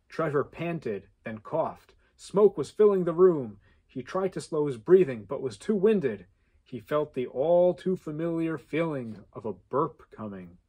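usual speaking voice

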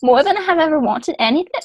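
mock Derry Irish accent; put-on Northern Irish accent. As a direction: go full Derry Girls Irish. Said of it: "I'm going full Derry Girls".

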